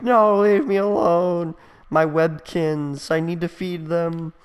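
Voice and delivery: put-on high, slow, drawn-out childish protest; mock desperate wail. Emo kid pulled back by his braids, wailing in protest. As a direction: cry out like a hammed-up tantrum.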